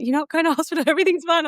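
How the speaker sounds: mock reassuring, pitched well up; imitating everyone soothing her, high; reassuring others. Everyone soothing a panicking mum, high mock reassurance. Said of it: "Everyone's like".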